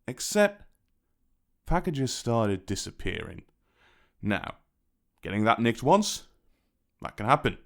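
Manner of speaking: usual speaking voice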